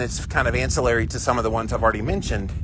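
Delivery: ordinary talk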